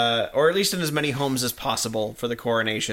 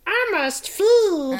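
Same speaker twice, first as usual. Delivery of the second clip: much higher, slow, louder villain line; high pitched; 4 dB louder, much slower than usual. Pretend. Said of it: "You're just changing the pitch".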